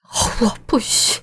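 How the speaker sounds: breathless whispered ouch of pain; pained wince. Acted. Man hurt after the breakup scuffle, whispered pained wince. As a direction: hiss it out like it stings.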